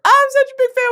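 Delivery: fan voice. Retold or imitated. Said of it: "they swam over".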